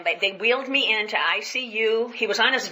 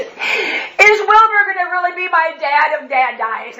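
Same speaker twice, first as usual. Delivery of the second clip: put-on high, slow, louder little boy; child's voice; 9 semitones higher, 7 dB louder, slower than usual. Small son asking his mother, high and slow child voice.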